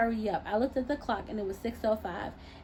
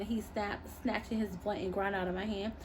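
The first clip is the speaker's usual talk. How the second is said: usual speaking voice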